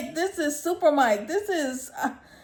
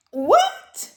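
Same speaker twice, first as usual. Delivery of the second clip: much higher, louder, disbelieving what; incredulous; 12 semitones higher, 5 dB louder than usual. Real, not acted. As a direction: shriek the question in disbelief. Reacting to Tyson losing.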